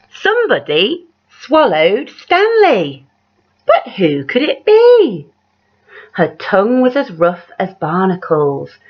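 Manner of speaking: lively read-aloud with big rises on the refrain; story narration. A narrator's reading. Reading a picture book aloud to children, animated storyteller voice. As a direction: tell it like a bedtime picture book.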